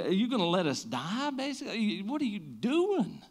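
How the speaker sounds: high, pleading cry of the disciples; a frightened plea up at Jesus; panicked disciples. Acted. Disciples waking Jesus in the storm, high panicked plea.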